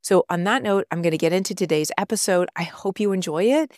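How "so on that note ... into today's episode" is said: ordinary talk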